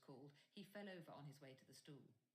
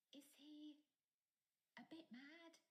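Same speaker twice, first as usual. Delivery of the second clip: a lightly voiced unsure boy, pitched higher; uncertain; 12 semitones higher, 3 dB quieter, slower than usual. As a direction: ask hesitantly, half afraid to. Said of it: "he asked Percy uncertainly".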